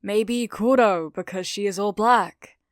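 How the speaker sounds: pondering. Acted. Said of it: "Ida pondered".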